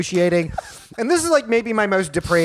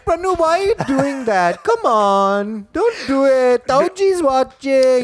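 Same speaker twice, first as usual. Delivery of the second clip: mock scolding mom; 7 semitones higher, slower than usual. Mom scolding son, retold.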